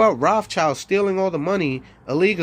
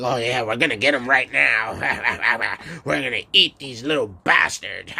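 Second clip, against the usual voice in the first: low, gruff mock police officer; mock cop voice; 5 semitones lower than usual. Imitating police answering a caller, low and smug. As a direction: play a smug cop promising to eat them. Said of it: "And the police is like".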